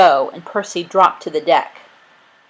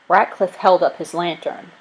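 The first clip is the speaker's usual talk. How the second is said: usual speaking voice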